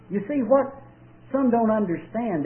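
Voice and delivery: ordinary delivery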